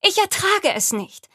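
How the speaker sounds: high, loud, anguished plea; distraught cry. Acted. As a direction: cry out that it's unbearable. Granddaughter protests to grandmother.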